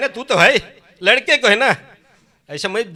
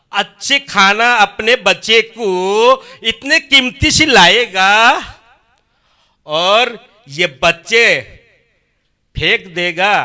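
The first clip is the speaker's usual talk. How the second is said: emphatic; 3 semitones higher, 7 dB louder, slower than usual. Real, not acted. पिता के डांटने को समझाता.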